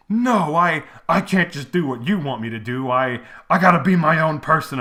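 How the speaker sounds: mocking, emphatic retelling of a dramatic speech; mock defiant protest. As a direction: imitate an overwrought character standing up for himself. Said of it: "he tells Anthony Quinn, like".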